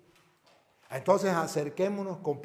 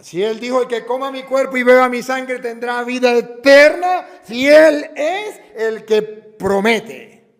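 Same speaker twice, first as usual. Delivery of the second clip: loud, higher, slow preaching cry; fervent preaching; 6 semitones higher, 15 dB louder, slower than usual. Acted. Priest proclaiming Christ's promise to the faithful, loud and fervent. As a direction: proclaim the promise with full force.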